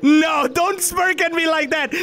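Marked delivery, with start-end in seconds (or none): none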